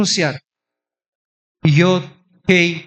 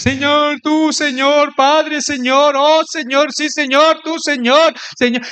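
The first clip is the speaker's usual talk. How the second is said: high, insistent mock prayer; imitating repetitive prayer; 9 semitones higher, slower than usual. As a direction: pile up fervent pleas in a raised voice. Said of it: "Primero decimos".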